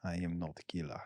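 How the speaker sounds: low, soft mock Russian accent. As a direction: echo the line in a deep, quiet Russian accent. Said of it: "I read it in a Russian accent".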